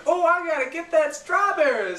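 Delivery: very high, loud squeal; hammed-up impression of an eager pop star; mock excitement. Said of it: "and she's like".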